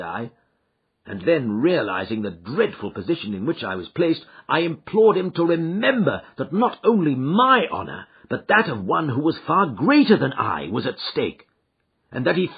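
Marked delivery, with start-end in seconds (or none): none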